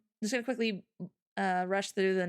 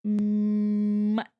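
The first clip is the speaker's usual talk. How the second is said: Mutt said long and louder; a louder, stretched out Mutt; emphasized mutt; 6 dB louder than usual. Real, not acted. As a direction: lean hard on Mutt, drawn out. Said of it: "I had to emphasize on the mutt".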